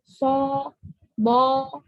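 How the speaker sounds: half-rounded heavy "So, bo"; thick forward vowels. Shown as a demonstration. Teacher showing isti'la shape, thick vowels. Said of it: "agak maju".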